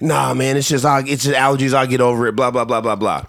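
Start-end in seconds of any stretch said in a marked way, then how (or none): none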